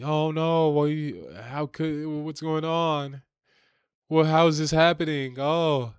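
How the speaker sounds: hammed-up fretting bystander; mock panicked reaction.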